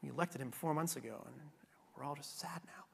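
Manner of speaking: hushed, mock-mournful listeners; mock sad whisper. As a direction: whisper it like glum voters. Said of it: "they're gonna be like".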